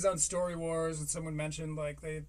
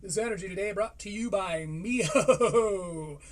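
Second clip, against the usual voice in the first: mock ad read; 4 dB louder, slower than usual. Sponsor plug mid podcast.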